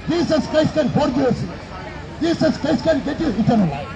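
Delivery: ordinary talk, nothing marked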